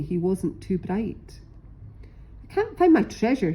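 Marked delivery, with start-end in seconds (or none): 2.4-3.5 high, fast, fretful pirate voice; a dim pirate whining about his lost map; frowning pirate. A narrator's reading. Dim pirate frets over his lost treasure, high and fast. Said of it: "he said with a frown".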